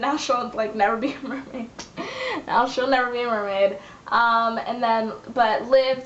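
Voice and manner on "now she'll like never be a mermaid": words giving way to a laugh; amused, about to crack up. Real, not acted. Vlogger explaining the lost mermaidness, cracking up.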